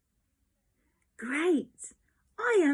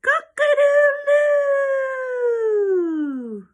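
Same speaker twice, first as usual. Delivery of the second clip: loud, high, slow crowing; full-throated rooster call; 10 semitones higher, 10 dB louder, much slower than usual. Shown as a demonstration. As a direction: crow it out like a rooster at dawn. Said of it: "It's called a cockerel".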